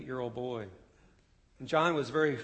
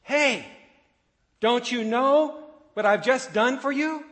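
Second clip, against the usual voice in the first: screamed at the top of the lungs, much higher and louder; desperate scream; 10 semitones higher, 10 dB louder than usual. A narrator's reading. Father on the bridge screaming at oblivious passengers, full-throated scream. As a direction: yell across at the passing train with everything you have. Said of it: "John screamed at the top of his lungs".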